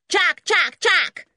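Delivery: very high, loud bird chatter; fierce jackdaw chatter. Acted. Jackdaw answering the twins from the roof, fierce. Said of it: "just as fiercely".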